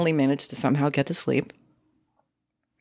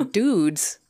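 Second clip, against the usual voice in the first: ordinary talk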